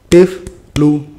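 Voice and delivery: "tiflu" broken by a silent gap after the f; wrong pause. Shown as a demonstration. Teacher shows the mistake, gap splitting "tiflu". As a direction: stop between tif and lu in "tiflu". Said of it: "nggak ada jeda di situ".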